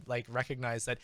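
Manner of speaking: ordinary talk, nothing marked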